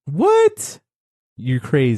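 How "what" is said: high squeaky disbelief; shocked. Real, not acted. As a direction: shoot the voice way up in shock. Disbelief at never seeing Mulan.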